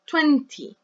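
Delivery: "Twenty" with the first syllable punched; stress on TWEN. Shown as a demonstration. Teacher marks the stressed syllable, stress on TWEN. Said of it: "what syllable is stressed".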